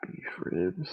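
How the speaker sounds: whispered order aside; whispering. Real, not acted. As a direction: whisper it off mic. Whispering orders to someone off mic.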